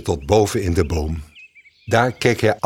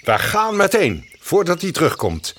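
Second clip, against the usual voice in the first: a touch higher, urgent bird; lightly voiced bird; 10 semitones higher than usual. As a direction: hurry the mouse along kindly. Mockingbird voice. (lichte vogelstem, gehaast)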